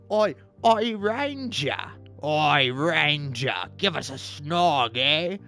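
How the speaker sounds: Cockney orc leering for a kiss, shouted up front; a rowdy Uruk calling out in a rough London accent; Uruk orc impression. Orc pesters the Ranger for a snog, rowdy Cockney orc voice. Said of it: "trying to do an impression of the orc".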